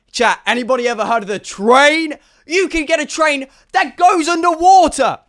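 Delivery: loud, high, triumphant proclamation; excited announcement. Acted. Streamer revealing the train plan to chat, excited shouting.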